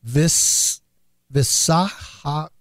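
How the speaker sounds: halting, slow try at a hard name; tentative pronunciation attempt. Real, not acted. Host attempting an Indian town's name, hesitant sounding-out. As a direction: sound out VISAHA in broken pieces. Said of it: "I'm gonna try it".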